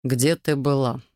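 stern. A narrator's reading. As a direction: question her with mild strictness. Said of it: "строгим голосом спросил Дэн". (строгим голосом)

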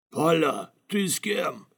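high-pitched calling out; shouting. A narrator's reading. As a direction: call across the fight for an answer. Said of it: "снова закричал Сафик". (окликая криком)

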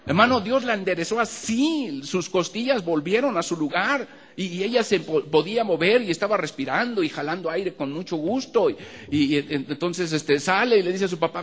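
high, loud testimony; fervent preaching. Acted. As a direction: build to the miracle. Preaching climax on healing. (predicación exaltada, aguda y fuerte)

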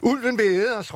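cry out. Acted. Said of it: "råbte Julie".

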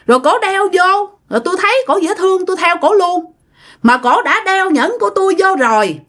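high, smug, needling ghost husband; spiteful ghost voice. Retold or imitated. Possessed mother as the dead husband, high and spiteful. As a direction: sneer it out like a jealous ghost claiming his wife. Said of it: "nói làm cái giọng thấy ghét lắm".

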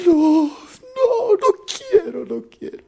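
very high, loud mock bawling; wailing simpleton. Simpleton refusing the king's daughter, hammed-up high tearful wail. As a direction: bawl the refusal like a crybaby. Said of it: "Más lloroso y más".

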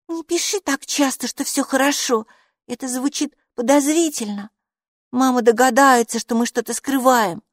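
high-pitched cautioning voice; warning character. Acted. Хилла warns in higher character voice.

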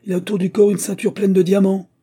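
high, loud cry to the crew; urgent shout. A narrator's reading. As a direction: shout to spur on rescuers. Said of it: "cria l'agent".

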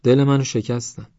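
hurt complaint. Acted. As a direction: complain that they hurt you. Original complaint, later mimicked.

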